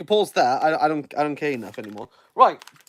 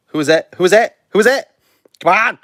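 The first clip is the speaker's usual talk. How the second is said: pressing impatiently for the winner's name; eager; 5 dB louder, faster than usual. Real, not acted. Waiting on the Nobel literature announcement, eager and building.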